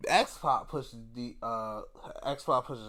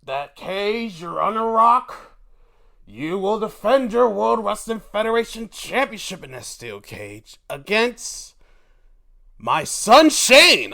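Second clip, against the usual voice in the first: announcing the match; 5 semitones higher, 8 dB louder than usual. Retold or imitated. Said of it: "They're like".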